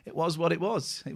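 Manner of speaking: soft laugh between the words. Retold or imitated. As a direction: imitate someone laughing it away. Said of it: "he just laughs and goes".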